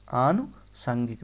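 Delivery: आनुसांगिक slowly, pause after a long आनु; broken up. Shown as a demonstration. Teacher showing how it will be written, slowly broken up. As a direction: split आनुसांगिक into आनु and सांगिक. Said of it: "देखो कैसे लिखा जाएगा".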